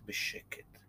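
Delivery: whispered "בשקט", barely audible. A narrator's reading. Narrator echoing the quiet snake, whispered. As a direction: breathe it out as a whisper. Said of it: "תמיד בשקט".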